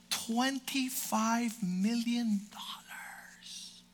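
slow hushed whisper of the sum; awed whisper. Acted. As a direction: breathe the number slowly, in awe. Awed whisper of the huge salary.

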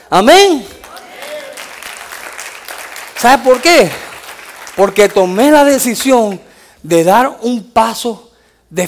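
loud, driving sermon delivery that builds toward the amens; fiery preaching. Acted. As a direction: preach it full force to the congregation. Preaching to congregation, rising fervour.

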